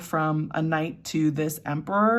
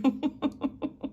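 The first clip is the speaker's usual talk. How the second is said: high giggle; 9 semitones higher than usual. Real, not acted. Giggles at dramatic energy.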